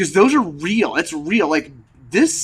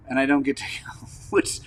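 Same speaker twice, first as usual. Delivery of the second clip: usual speaking voice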